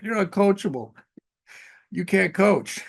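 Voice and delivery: mock the coach chewing out his quarterback; coach yelling. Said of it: "their coach Saturday yelling at the quarterback".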